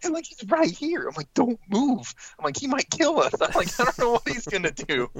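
high, frantic warnings; terrified kid pleading not to move; panicked whisper-yell. Retold or imitated. Panicked warnings next to the bull.

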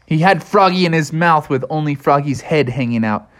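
usual speaking voice

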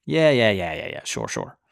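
scoffing yeahs fading to a mutter; mocking dismissal. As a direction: brush him off like a joke. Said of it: "So they mocked Lot".

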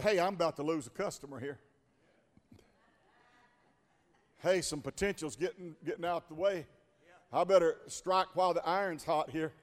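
quick, hushed beggar muttering to himself; scheming beggar. Acted. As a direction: rush it low like a sly beggar. Preacher voices the lame beggar.

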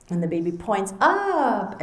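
ordinary talk, nothing marked

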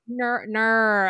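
"nur" with a long r-coloured vowel; exaggerated "nur", vowel bent toward R; internet's Aussie no. Shown as a demonstration. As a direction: say "nur" as the mocked Australian no, drawn out. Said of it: "the way that people are sort of spelling it".

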